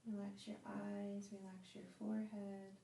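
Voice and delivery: slow, drawn-out phrases with long pauses; guided meditation. Acted. Yoga teacher guiding students to relax on their mats, guided meditation.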